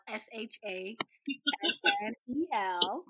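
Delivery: letter by letter, each letter spoken separately; spelling it out. Shown as a demonstration. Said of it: "That's spelled".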